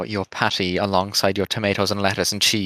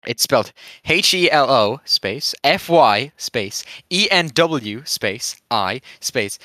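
ordinary talk, nothing marked

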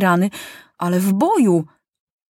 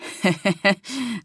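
light soldier's laugh; amused chuckle; 3 semitones higher than usual. A narrator's reading. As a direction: chuckle at the boy's bold toast. Soldier amused by boy's slogan.

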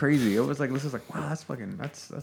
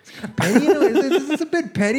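laughing; 5 dB louder, faster than usual. Real, not acted. Laughing about the petty revenge.

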